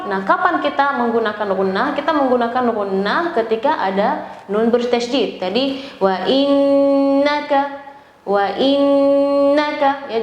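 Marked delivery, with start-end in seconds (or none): 5.4-10.1 innaka with the doubled n held two beats, slowly; held ghunnah. Shown as a demonstration. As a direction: stretch the nn in innaka, nasal and slow. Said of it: "jangan wa innaka terlalu cepat".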